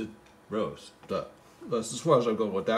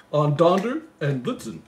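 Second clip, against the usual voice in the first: poem recital; 3 semitones higher, 4 dB louder than usual. Acted. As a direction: read it like a bedtime Christmas poem. Reading the poem aloud.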